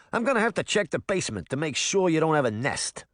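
the cat reports in New Jersey; mildly voiced New Jersey exterminator; New Jersey accent. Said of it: "in a thick New Jersey accent".

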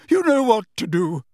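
high, loud, wounded outburst; high-pitched, somewhat hysterical. A narrator's reading. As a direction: let hurt pride tip into hysteria. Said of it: "her voice high-pitched and somewhat hysterical".

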